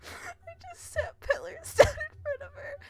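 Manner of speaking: wheezing with laughter; laughing. Real, not acted. Laughing retelling the glitch.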